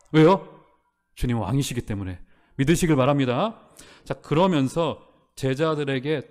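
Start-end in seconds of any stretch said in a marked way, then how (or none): none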